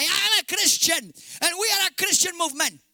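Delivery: high, loud proclaiming politician; shouted quote. Retold or imitated. Preacher mimicking politicians proclaiming their faith, loud and high. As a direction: belt out their claim up high.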